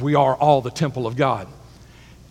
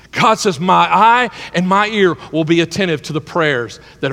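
much higher and louder, fervent preaching; preaching at full force; 9 semitones higher, 6 dB louder than usual. Acted. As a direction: lift the congregation with rising fervor.

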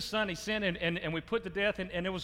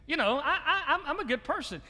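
higher, mock self-satisfied, laughing at himself; smug self-talk; 7 semitones higher than usual. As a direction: play the smug good person, half joking. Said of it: "you think to yourself".

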